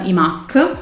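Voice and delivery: ordinary talk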